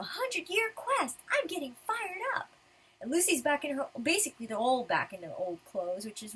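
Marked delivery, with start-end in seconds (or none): 0.0-2.5 high, keyed-up cheer; raring to set off on a quest; fired-up eagerness. Acted. Adventurer setting off on the hundred-year quest, fired up.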